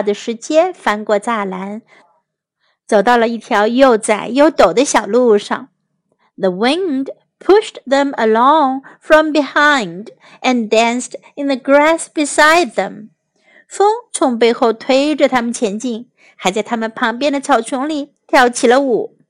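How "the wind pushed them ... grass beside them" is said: usual speaking voice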